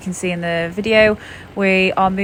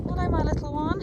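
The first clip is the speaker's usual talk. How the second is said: talking to a lamb; 9 semitones higher, 4 dB quieter, faster than usual. Real, not acted. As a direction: coo softly to a little lamb. Greeting a young ewe.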